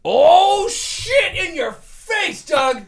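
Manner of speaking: high, loud gloating yell, slowed on face; gloating shout. Real, not acted. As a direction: rub the win in his face. Gloating at winning the argument.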